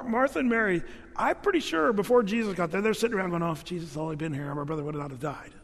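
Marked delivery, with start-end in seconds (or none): 3.4-5.5 low, soft, quick aside; lightly voiced private complaint; muttered grumbling. Sisters talking before Jesus arrives, muttered grumbling.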